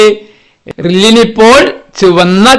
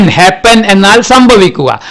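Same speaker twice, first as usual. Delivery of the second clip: ordinary talk, nothing marked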